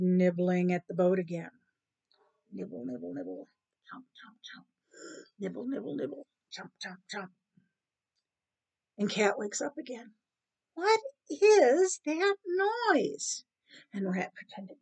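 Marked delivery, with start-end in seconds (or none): 2.5-7.3 very soft, half-whispered nibbling noises; secretive nibbling. Acted. Rat gnaws while cat sleeps, hushed and secretive. 10.7-13.4 high, very drawn-out demand; irritated woken cat. Acted. Cat woken by gnawing, exasperated.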